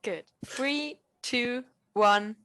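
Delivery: usual speaking voice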